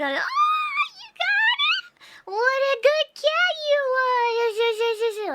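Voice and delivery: put-on baby talk to cat. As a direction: gush over a good kitty. Praising her cat each time.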